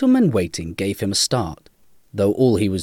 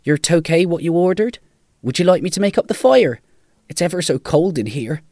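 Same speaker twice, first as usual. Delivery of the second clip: put-on higher-pitched, helpful young server; raised, questioning, deferential voice; polite young Malcolm; 8 semitones higher, 5 dB louder, faster than usual. A narrator's reading. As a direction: pitch it up, politely offering service. Narrator voices young Malcolm serving.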